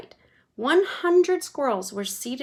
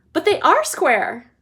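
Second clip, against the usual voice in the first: higher, louder, gleeful showman; Wonka voice; 6 semitones higher, 9 dB louder, slower than usual. A narrator's reading. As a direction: Wonka answering Veruca, playful showman voice. Wonka's voice in story.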